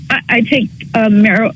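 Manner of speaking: ordinary delivery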